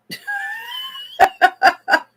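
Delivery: high, rippling laugh; real high-pitched peal of laughter. Real, not acted. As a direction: laugh out loud at your own mistake. Laughs at own mix-up.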